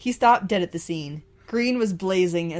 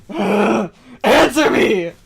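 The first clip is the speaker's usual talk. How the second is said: angry shout; 3 semitones higher, 12 dB louder, much slower than usual. Acted. Green shakes Red.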